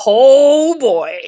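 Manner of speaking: loud, high, drawn-out exclamation; big exclamation. Real, not acted. Podcaster introducing her game chaos segment, loud exclamation. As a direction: belt it out, bracing for chaos.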